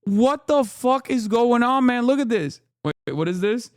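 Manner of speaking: normal talking voice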